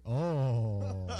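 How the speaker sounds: laughing. Real, not acted. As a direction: laugh breaking through. Laughing at surprise question.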